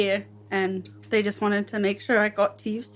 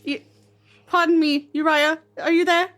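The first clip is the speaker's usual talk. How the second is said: timid calling out; 7 semitones higher, 3 dB louder than usual. Acted. Calling into the room.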